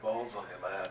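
laughing through the first word, then mocking; laughing taunt. Lobo laughing at Token's nerve, mocking taunt. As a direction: sneer it out mid-laugh. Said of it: "Lobo just starts laughing".

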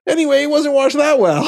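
real laugh breaking into the words; high-pitched, laughing through the admission. Real, not acted. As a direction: laugh as you tell on yourself. Laughing at own food poisoning story.

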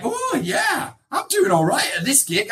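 high-pitched, delighted "oh yeah"; acted-out inner cheer, high voice; pleased self-congratulation. Acting his pleased self-talk.

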